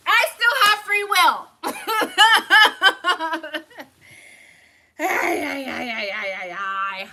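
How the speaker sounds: loud high cackling laughter; shrieking laughter with high cries; laughing fit. Real, not acted. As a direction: laugh out loud at her message. Laughing fit at Melissa's message.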